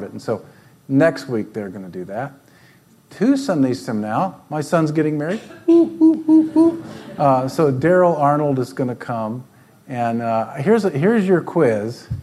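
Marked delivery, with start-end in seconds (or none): 5.7-6.8 high, loud celebratory whoops; excited whooping. Real, not acted. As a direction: whoop it up with joy. Celebrating son's upcoming wedding.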